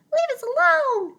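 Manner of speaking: very high, loud squeaking weasel. Acted. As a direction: squeak in terror from the grass. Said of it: "squeaked Dart".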